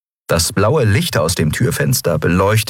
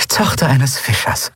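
broad smile; 7 semitones higher than usual. A narrator's reading. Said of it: "Das Lächeln wurde breiter".

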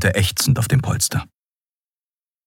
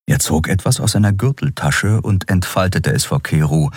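usual speaking voice

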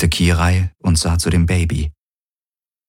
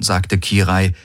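usual speaking voice

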